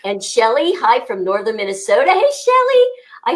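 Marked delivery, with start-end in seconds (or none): 2.1-2.8 high, loud hello; excited greeting. Real, not acted. Host greeting a viewer from northern Minnesota, excited greeting. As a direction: call out a big high-pitched hello.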